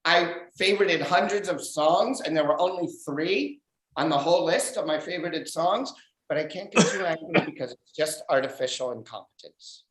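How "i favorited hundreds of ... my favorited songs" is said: ordinary talk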